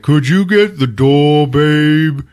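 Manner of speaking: loud, slow, dragged-out reply; mock oafish husband voice; acted lazy husband. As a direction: toss the request back lazily and loudly. Lazy husband passes it back.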